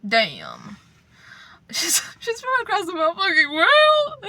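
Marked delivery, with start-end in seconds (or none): 1.7-4.2 mock-anguished cry, pitched way up; high half-crying exclamation; high, loud dramatic wail. Real, not acted. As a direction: wail it like it's a tragedy. Repeating the line, dramatic outburst.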